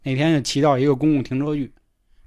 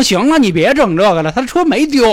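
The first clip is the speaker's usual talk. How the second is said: louder and higher, cutting him off; police officer snapping; 7 semitones higher, 7 dB louder than usual. Retold or imitated. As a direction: bark it like a fed-up cop. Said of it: "警察说". (不耐烦的警察大声呵斥)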